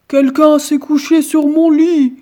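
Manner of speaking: papa bear, booming and outraged; grand ours. A narrator's reading. Grand ours voiced in tale. (voix tonnante du grand ours)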